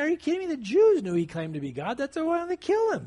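high-pitched, emphatic disbelief; incredulous rebuttal. Real, not acted. Preacher rebutting cults' claim about Jesus, incredulous. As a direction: push back hard, pitch leaping up in exasperation.

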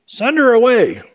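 high, dismissive imitation; mock annoyance. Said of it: "annoying people".

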